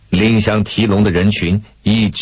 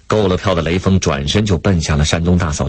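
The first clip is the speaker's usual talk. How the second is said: ordinary delivery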